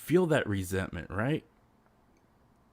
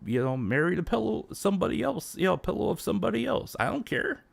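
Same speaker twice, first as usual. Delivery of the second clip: exasperated rise; 9 semitones higher than usual. Real, not acted. Exasperated rant about fanboys.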